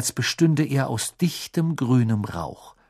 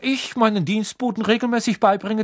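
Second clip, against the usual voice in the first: kalte Wut; 12 semitones higher, 3 dB louder than usual. A narrator's reading. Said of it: "mit kalter Wut in jeder Silbe".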